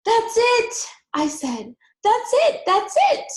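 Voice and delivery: high, loud triumphant cries; eureka shout. A narrator's reading. Girl shouting her discovery to parents.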